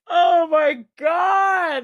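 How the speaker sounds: high, drawn-out helpless laughter; real laughing-crying, words stretched; sobbing with laughter. Real, not acted. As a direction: gasp it through real tears of laughter. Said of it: "I'm sobbing".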